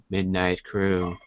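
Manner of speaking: low, soft dramatic announcer; ominous title drop. Pretend.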